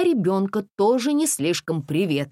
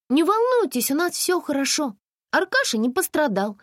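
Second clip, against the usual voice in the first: put-on higher girl voice, reassuring; Masha reassuring; 12 semitones higher, 3 dB louder than usual. Girl reassuring the mothers, slightly higher. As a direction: tell them all is well.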